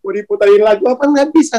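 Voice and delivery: ordinary delivery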